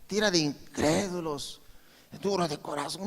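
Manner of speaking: hushed mock rebuke; soft imitation of a scolding voice; a quiet hint of a stern rebuke. Imagined scolding Jesus did not give.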